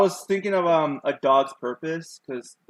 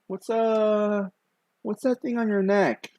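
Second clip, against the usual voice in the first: put-on higher, slower curious old man voice; old man asking; 9 semitones higher, 5 dB louder, slower than usual. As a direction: play the old juggler squinting at a kid's neck, higher and slow. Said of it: "And he goes".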